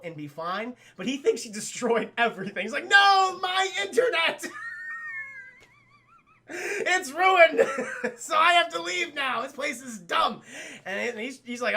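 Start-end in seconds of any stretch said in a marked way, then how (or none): 2.9-4.5 high, very loud mock wail; hammed-up anguished shout. Hobgoblin thinking he destroyed everything, over-the-top anguished shout. 4.5-5.5 very high, drawn-out squeal; exaggerated wordless keening, softer than the shout; mock wail. Hobgoblin mourning his internet, hammed-up high wail. 6.5-10.4 high, loud mock sobbing; hammed-up crying. Hobgoblin leaving his ruined base, loud fake-tearful wail.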